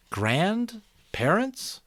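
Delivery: high, very slow wondering rise; puzzled question. Acted. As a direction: taste the strange new word, astonished. Jonas hears a strange word.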